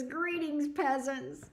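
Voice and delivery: high, lofty lord addressing his subjects; grand royal greeting. Pretend. Reading a viewer's joke aloud, in a high mock-royal voice. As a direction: greet the commoners like a haughty king. You're so crazy.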